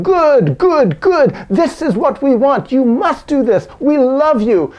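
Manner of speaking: high, loud, eager praise, a laugh breaking through; beaming encouragement to a sobbing man; encouraging Marines. Retold or imitated. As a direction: cheer them on like a coach who loves them. Marines encourage the crying prisoners.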